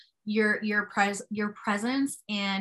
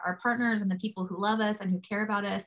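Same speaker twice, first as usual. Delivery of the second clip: ordinary delivery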